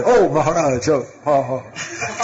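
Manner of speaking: acted startled "oh", pitch leaping then dropping; mock flustered surprise. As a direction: play caught off guard, ending in a small awkward laugh. Devotees caught watching TV by guru.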